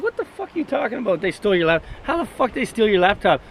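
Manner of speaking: bewildered cop husband snapping back; mock angry cop husband. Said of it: "He'd be like".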